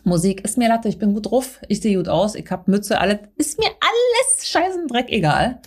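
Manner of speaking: ordinary delivery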